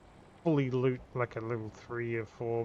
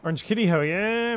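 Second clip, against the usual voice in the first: loud, high whoop over the loot; high shouted hell yeah; excited cheer; 8 semitones higher, 10 dB louder than usual. Real, not acted. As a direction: celebrate the find out loud. Finds rare loot, cheers.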